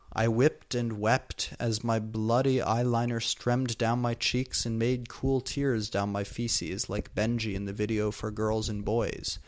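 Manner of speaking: usual speaking voice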